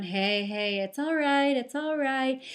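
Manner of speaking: put-on sing-song reassurance to a baby; soothing a baby. Shown as a demonstration. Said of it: "calm baby down".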